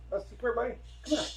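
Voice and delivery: high-pitched call to a pet; high, friendly beckoning; coaxing dog call. Real, not acted. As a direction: coax the dog over in a high voice. Calling the other dog over.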